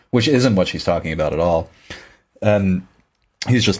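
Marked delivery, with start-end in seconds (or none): none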